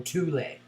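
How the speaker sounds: Thule as two syllables, TOO-lay; alternative pronunciation. Shown as a demonstration. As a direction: say Thule with a hard T and a final -ay. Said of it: "whichever way you want to say it".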